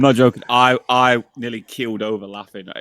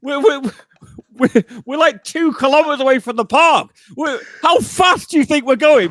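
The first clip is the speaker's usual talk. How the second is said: high, shouting disbelief; arguing the distance with his friend, high shouting rant; exasperated rant; 3 dB louder than usual. Real, not acted. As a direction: rant at the absurdity, voice way up. Ranting about the distance.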